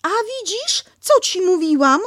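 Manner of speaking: brisk scolding; Kanga scrubbing. Acted.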